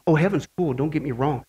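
ordinary talk, nothing marked

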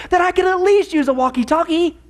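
ordinary talk, nothing marked

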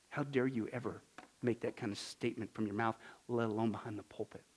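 soft, low indignation; grave hushed rebuke. Acted. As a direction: rebuke him quietly and gravely. Grave rebuke of false teachers.

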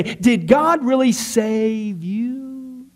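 very slow, high, drawn-out question; doubt-sowing devil. Acted. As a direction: imitate the devil casting doubt, dragging out the last words. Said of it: "he'll use the same tactic of a doubt".

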